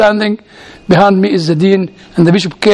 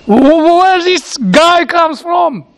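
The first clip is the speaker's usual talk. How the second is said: stammered, higher puzzled question; lightly acted bewilderment, slowing right down; drawn-out, higher-pitched mock puzzlement; mock bafflement; 7 semitones higher, slower than usual. Acting out puzzlement at the foreigner.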